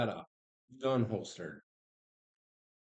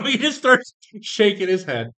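laughing; 9 semitones higher, 10 dB louder than usual. Real, not acted. GM laughing at own slip.

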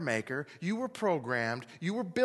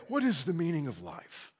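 lightly voiced hookah-smoking musing; stoned philosopher; 4 semitones lower, 4 dB quieter than usual. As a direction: drift through the questions like a stoned philosopher. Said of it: "smoke a hookah and they'd say".

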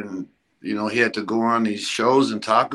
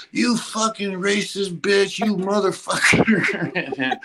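higher, slow furious outburst; exploding at her; 8 semitones higher, much slower than usual. Retold or imitated. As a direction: tear into her, high and drawn out. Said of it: "He just exploded on her".

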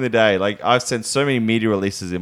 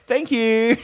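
much higher, sing-song thank you; sweet thanks; 12 semitones higher than usual. Retold or imitated. To the cashier after getting the email, a very high sugary thanks. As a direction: chirp it up high, all sweetness.

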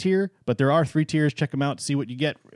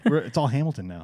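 ordinary talk

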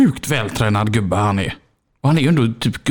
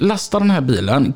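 ordinary delivery

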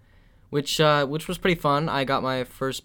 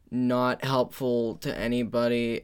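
ordinary delivery

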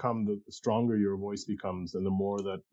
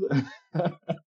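short real laugh, high and rising; a quick genuine chuckle; a bright burst of laughter; amused laugh; 9 semitones higher than usual. Real, not acted. Laughs at calling poems rants.

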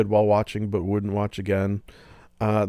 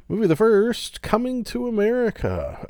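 grand announcement; 12 semitones higher than usual. Real, not acted. Host introducing the film, grand announcer style. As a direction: announce the first movie with flair. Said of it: "announcing movie title".

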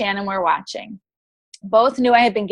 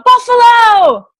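long drawn-out yell, much higher and louder; 13 semitones higher, 11 dB louder, much slower than usual. Retold or imitated. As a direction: shout it to the crowd, slowly. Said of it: "and then I yell".

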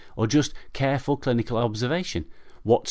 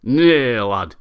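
louder, slowed, grumbling northern reply; grumpy drunk; 3 semitones higher, 8 dB louder, slower than usual. Acted. Desperate drunk Arthur grumbles back at his neighbour, slow and loud. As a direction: brush off the question with drunken grumpiness. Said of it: "grumpily responds the desperate scrabbler".

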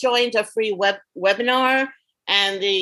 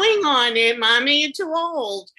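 higher-pitched mock daughter telling off Mom; imitated daughter; 6 semitones higher than usual. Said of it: "my daughter said".

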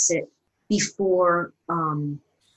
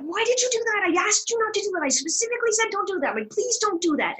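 high, pleading and exasperated; in a rage; 11 semitones higher than usual. Retold or imitated. Actress onstage at her castmate, high exasperated rage. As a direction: fire it off, upset and pleading. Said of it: "I'm in a rage".

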